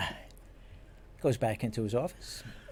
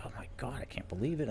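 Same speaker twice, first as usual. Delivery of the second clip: whispered disbelief, fading off; 6 dB quieter than usual. Retold or imitated. Hurt detective alone in his office, whispered. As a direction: breathe it out, hurt and stunned. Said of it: "I'm like".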